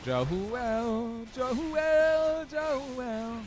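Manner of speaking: high drawn-out calls; calling out. Pretend. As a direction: call out the name from afar. Calling out the name.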